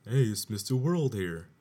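lightly hammed-up commercial jingle read; commercial announcer. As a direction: hit the slogan like a TV ad. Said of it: "and my first thought was".

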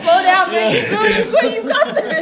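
loud, high laughing encouragement; mock coach. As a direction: cheer him on loudly while laughing. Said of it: "No, I".

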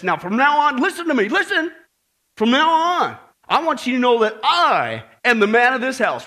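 loud bossy man of the house; roaring tyrant king. Retold or imitated. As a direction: bellow orders like a pompous tyrant. Bill storms in demanding obedience.

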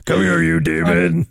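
low, gruff demon-hunter voice; mock Doom growl. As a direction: snarl it like a game hero. Quoting Alex Jones meme as Doom.

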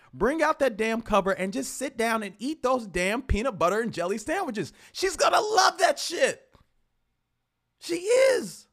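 loud, laughing enthusiasm; excited shout. Real, not acted. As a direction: hype it up like a pep talk. Excited advice on date night.

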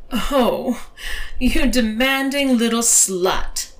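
mock scolding, stretched out; teasing. A narrator's reading. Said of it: "she teased".

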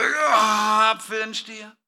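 a branch pushing hard to make an apple; mock straining branch. Preacher voicing a branch straining to grow an apple. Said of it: "Der Zweig war so".

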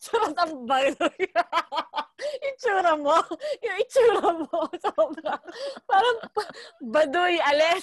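laughing high and bright at own corny line. Real, not acted. Woman laughing at her own corny answer, giggling. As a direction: giggle through the words, embarrassed.

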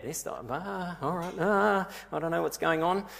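acted flustered confusion. Preacher voicing Saul's racing thoughts, flustered. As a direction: play a mind overloaded, breaking into "ah". Acts Saul's mind in a muddle.

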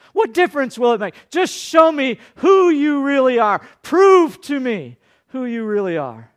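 Satan daring Jesus to prove himself, growing to a shout; Satan taunting. Retold or imitated. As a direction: goad him, rising to a shouted dare. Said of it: "he tries to get Jesus".

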